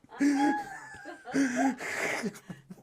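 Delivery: high, loud laughing fit; long laugh. Real, not acted. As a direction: let a long laugh roll out.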